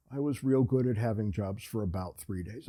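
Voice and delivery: usual speaking voice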